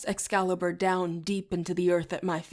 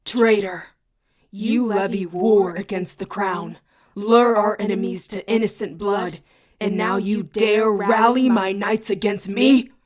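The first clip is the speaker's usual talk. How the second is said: booming baritone; 2 semitones higher, 8 dB louder than usual. A narrator's reading. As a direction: thunder it like an angry king condemning a traitor. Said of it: "in a booming baritone".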